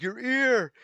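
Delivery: high, loud alarmed shriek; onlooking god shocked at a friend's shredded ear, crying out; panicked cry. Acted. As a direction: yelp it in alarm. God freaks out at wound.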